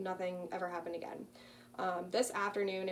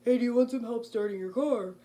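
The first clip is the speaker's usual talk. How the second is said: mock deep voice; 3 semitones higher, 7 dB louder than usual. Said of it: "a deep voice".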